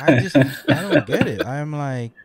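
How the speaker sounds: talking through real laughter; laughing. Real, not acted.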